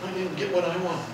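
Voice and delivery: put-on soft whiny pout like a six-year-old; a grown king whining like a pouting child. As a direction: sulk it out like a spoiled kid.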